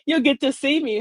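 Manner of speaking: ordinary delivery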